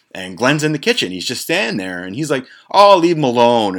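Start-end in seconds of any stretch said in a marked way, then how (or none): 2.7-3.8 imitated protest. Glenn in the kitchen defending the others, loud protest. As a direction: call it out as a mock complaint.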